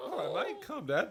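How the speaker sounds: usual speaking voice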